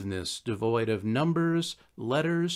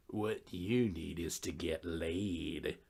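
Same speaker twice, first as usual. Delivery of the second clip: much lower, much softer, slow and knowing; a seducer's slow, low murmur of advice; smooth ladies' man; 8 semitones lower, 11 dB quieter, slower than usual. Acted. Mooch, ladies' man, gives advice.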